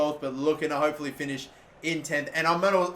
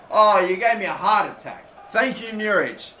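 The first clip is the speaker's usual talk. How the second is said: relieved outburst; 4 semitones higher, 6 dB louder than usual. Real, not acted. Relief after keeper save.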